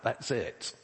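normal talking voice

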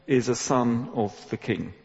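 ordinary delivery

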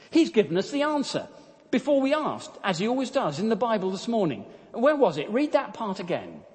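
high, eager excitement; excited. Acted. Betsy telling Corrie the answer, excited. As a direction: bubble over with sudden joy. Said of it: "Betsy then exclaimed excitedly".

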